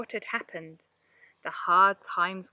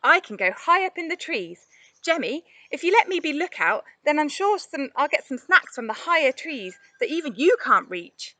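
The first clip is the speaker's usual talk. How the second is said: eager pleading; 6 semitones higher, 5 dB louder than usual. A narrator's reading. Monty pleading to be lookout.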